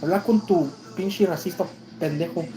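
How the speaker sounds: high heated Spanish tirade; cussing out in Spanish. Retold or imitated. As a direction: tell him off hard in Spanish. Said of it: "I started cussing him out in Spanish".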